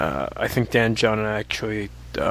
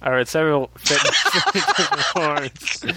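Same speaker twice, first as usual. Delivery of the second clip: laughing while reading; 4 semitones higher than usual. Real, not acted. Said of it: "Okay, stop".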